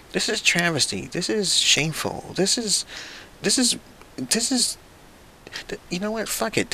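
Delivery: high, halting disbelief; words fail him, rising in pitch; sputtering outrage. Real, not acted. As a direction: gives up mid-sentence in disgust. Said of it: "sputtering outrage".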